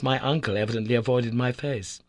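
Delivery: plain reading voice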